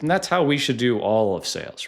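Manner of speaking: ordinary talk, nothing marked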